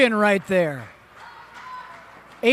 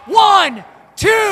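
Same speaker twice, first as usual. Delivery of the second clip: higher, louder pin count; shouted referee count; 6 semitones higher, 4 dB louder than usual. Real, not acted. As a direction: call out each number of the count.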